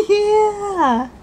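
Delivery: ordinary talk, nothing marked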